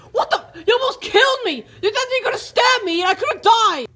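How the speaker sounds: outraged high yelling; angry shout. Acted. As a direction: scream about nearly being killed. Nearly stabbed by stick.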